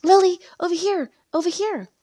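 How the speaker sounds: high, loud calling out; excited shout. A narrator's reading. Best friend hailing Lily across the cafe, shouting. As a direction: call across the room to get attention. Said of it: "immediately jumps up and shouts".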